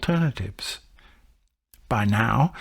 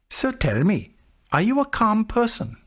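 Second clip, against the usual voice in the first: gently probing monk; 9 semitones higher than usual. Retold or imitated. Narrator voices the monk.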